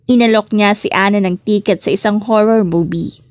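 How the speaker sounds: ordinary delivery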